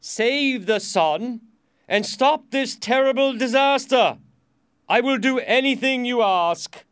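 frightened king's loud, high appeal; desperate plea. Acted. Frightened king begs prisoner.

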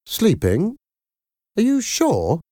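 high, rising disbelief; surprised. A narrator's reading. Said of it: "asked Miranda in surprise".

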